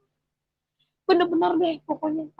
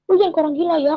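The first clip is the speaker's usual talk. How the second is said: mock telling-off, slightly higher and louder; retold angry scolding; 4 semitones higher, 5 dB louder, much faster than usual. Husband on motorbike scolds wife for waving skyward, mildly angry. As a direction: play the irritated husband, mildly. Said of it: "dia marah-marah".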